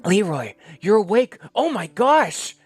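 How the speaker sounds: high, loud joyful exclamation, slowing on gosh; overjoyed cry. Acted. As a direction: burst out with relief that he woke. Nurse sees patient wake up.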